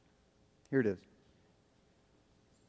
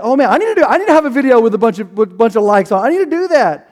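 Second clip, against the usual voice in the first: higher, louder, rushing mock panic; anxious social-media inner voice; 7 semitones higher, 7 dB louder, faster than usual. Pastor mocking how social media makes us feel, frantic mock panic. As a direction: ham up the frantic need to keep up. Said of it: "and we're like".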